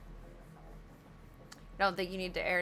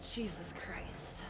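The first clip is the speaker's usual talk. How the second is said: slow, softer whisper; whispered exasperation; 10 dB quieter, much slower than usual. Real, not acted. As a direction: breathe it out defeated. Exasperated after failing.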